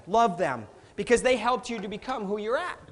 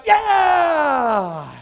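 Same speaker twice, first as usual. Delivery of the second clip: loud triumphant yeah; kid's victory yell; 4 semitones higher, 8 dB louder than usual. Retold or imitated. Son cheering as Luke wins, loud triumphant yell. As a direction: celebrate the win like a kid. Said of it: "he's like".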